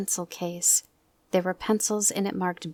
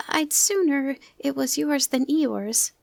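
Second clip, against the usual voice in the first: Piglet's voice; 8 semitones higher, 4 dB louder, slower than usual. Acted. Piglet speaks to Pooh.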